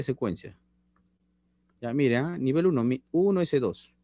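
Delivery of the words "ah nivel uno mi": ordinary delivery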